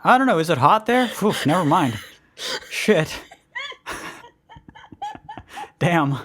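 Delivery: ordinary talk, nothing marked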